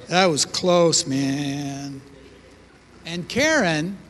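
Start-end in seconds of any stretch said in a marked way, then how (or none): 0.1-2.0 hushed, dazed hippie hush; laid-back hippie. Retold or imitated. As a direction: driver calming friends after near crash, hushed and dazed. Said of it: "I think I said something like".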